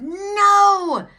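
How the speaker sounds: shrieked high, loud no; one sharp angry cry; furious no. Retold or imitated. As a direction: yell it out in pure frustration. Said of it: "I was so mad".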